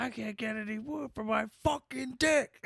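high-pitched imitation of a YouTube guy; lightly hammed-up high impression; mock Tourette's guy. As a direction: squeak it out high in his voice. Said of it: "He's like".

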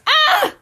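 short high shriek; frustrated scream. Real, not acted. Vlogger unable to delete accounts, high shriek. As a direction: let out a sharp scream.

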